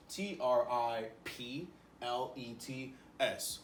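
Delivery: T R I P L E T S spelled slowly letter by letter. Shown as a demonstration. Teacher spelling T R I P L E T S slowly for the class. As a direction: spell T R I P L E T S out slowly for kids to copy. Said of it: "write this down".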